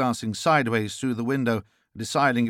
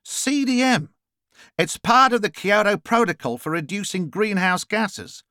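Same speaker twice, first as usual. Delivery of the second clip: higher-pitched, emphatic explainer; explaining character; 9 semitones higher than usual. Acted. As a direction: explain it high with punched key words. Character's dialogue in narrated story.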